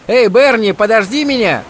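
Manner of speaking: loud high call across the beach; shouting. A narrator's reading. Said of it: "крикнул Кори".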